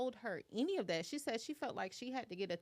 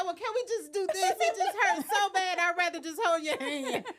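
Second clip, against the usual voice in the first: mock whiny client; 8 semitones higher, 9 dB louder than usual. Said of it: "She said".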